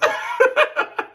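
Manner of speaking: real hearty laugh at own story; burst of laughter. Real, not acted. Laughing at own trip story, hearty burst of laughter.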